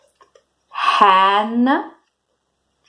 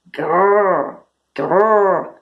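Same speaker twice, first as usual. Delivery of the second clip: sustained rolled growl; bear growl. Shown as a demonstration. Teacher giving kids a clue for the bear, growling. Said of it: "The bear says grr".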